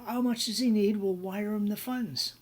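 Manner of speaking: put-on loud, high Australian accent; Australian fellow. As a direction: imitate a generous Aussie businessman making an offer. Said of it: "the Australian fellow".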